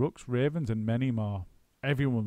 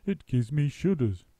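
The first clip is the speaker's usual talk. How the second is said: squeamish bird; 4 semitones higher, 4 dB louder than usual. A narrator's reading. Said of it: "said the roly-poly bird".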